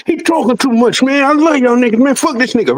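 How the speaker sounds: high-pitched imitated griping; mock indignation. Friends turning on Lo after he talks too much, mock indignation. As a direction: act out fed-up friends, pitched well up.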